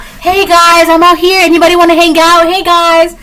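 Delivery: loud, high, attention-seeking call; eager calling out. Acted. Mimics calling out for company.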